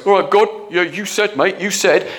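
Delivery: lightly mock a brash believer bossing God; arrogant demand. Shown as a demonstration. Preacher mocks an arrogant prayer, cocky and demanding. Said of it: "I'm not talking arrogantly".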